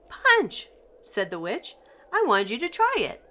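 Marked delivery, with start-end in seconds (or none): 0.1-0.7 very high, loud witchy answer; witch voice. A narrator's reading. Witch explaining her potion to Shaggy, a very high witchy answer. 2.1-3.2 high, fast witchy chatter; witch voice. A narrator's reading. Witch telling Shaggy why she made the punch, high and fast.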